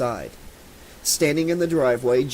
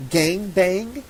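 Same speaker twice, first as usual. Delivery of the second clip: choked voice; 6 semitones higher than usual. A narrator's reading. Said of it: "in a choked voice".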